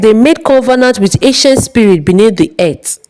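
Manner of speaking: usual speaking voice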